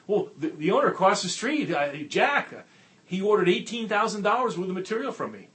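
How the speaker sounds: excited. Retold or imitated. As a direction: rookie insisting the sale is real. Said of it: "and he's excited".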